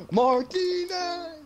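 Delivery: high, loud, drawn-out name call; hammed-up roar, stretching the name out; excited announcer. Imitating the hyped announcer introducing a player, excited long shout. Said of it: "excited guy, he's like".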